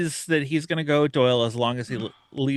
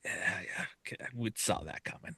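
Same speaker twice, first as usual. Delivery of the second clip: quiet deadpan; 2 semitones lower, 16 dB quieter than usual. Retold or imitated. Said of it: "he's like".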